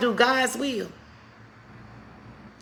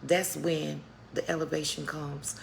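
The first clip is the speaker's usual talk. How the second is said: ordinary talk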